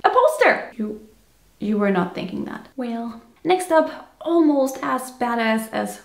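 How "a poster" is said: high, loud squeal of excitement; mock excitement. Listing merch the viewer might want, over-the-top mock excitement. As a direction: burst out as if it's the best idea yet.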